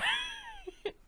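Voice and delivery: breathy little squeal of laughter; high squeaky laugh. Real, not acted. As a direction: giggle at the absurdity. Laughs after retold argument.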